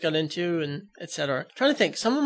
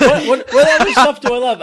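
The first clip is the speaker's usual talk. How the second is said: laughing; 7 semitones higher, 11 dB louder than usual. Real, not acted. Laughing while thinking aloud.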